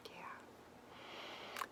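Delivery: whispered yeah; soft whispered aside. Real, not acted. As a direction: breathe it out in a whisper. Quiet aside to herself.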